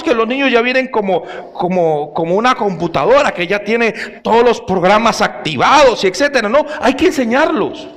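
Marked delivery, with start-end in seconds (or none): none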